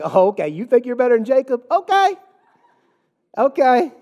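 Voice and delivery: mock-impressed, climbing high in pitch; exaggerated sarcasm, pitch rising to a squeak; sarcastic. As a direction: mimic a scornful "oh, okay" comeback. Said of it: "She, she is sarcastic".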